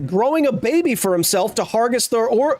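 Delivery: can you believe this, voice climbing high; incredulous. Real, not acted. Incredulous about the billionaire's plan.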